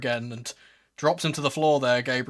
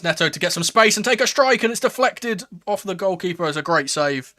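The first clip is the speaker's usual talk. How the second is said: excited; 4 semitones higher, 7 dB louder than usual. Real, not acted. Live call of shot.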